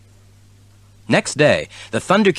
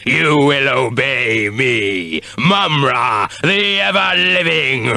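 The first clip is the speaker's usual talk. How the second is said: villain voice; slower than usual. A narrator's reading. Villain proclaiming.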